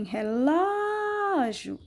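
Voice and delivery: "relógio" with a long, open LÓ; stressed open syllable. Shown as a demonstration. Teacher shows where the accent goes, long LÓ in "relógio". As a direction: hold the LÓ of "relógio" with the mouth wide. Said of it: "a boca fica bem aberta".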